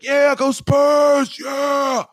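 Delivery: slow, high, drawn-out shout; yelled fan cheer. Retold or imitated. Retelling yells at people walking by, rowdy cheer. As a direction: holler it at a passing crowd. Retold yelling at passing crowd.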